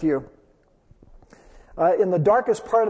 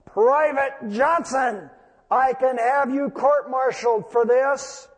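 acted growl; 5 semitones higher than usual. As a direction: throw your rank at him, seething. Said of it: "the captain growled back over the radio".

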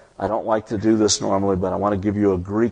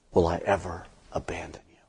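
hushed, lower whisper of the promise; 3 semitones lower, 6 dB quieter than usual. Acted. Preacher whispering God's promise to the congregation. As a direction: drop to a breath of a whisper.

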